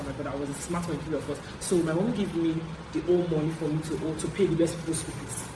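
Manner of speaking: normal talking voice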